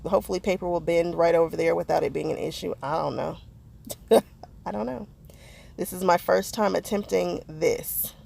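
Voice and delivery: ordinary talk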